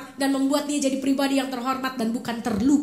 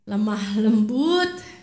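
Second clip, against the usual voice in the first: lemah lembut said slowly and low; gentle, drawn-out lemah lembut; 6 semitones lower, much slower than usual. Shown as a demonstration. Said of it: "harus dengan".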